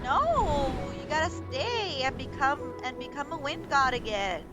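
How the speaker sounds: normal talking voice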